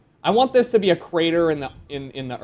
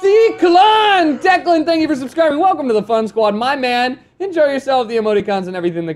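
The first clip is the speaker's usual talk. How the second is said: excited shout-out; 9 semitones higher, 7 dB louder than usual. Real, not acted. Thanking a new subscriber.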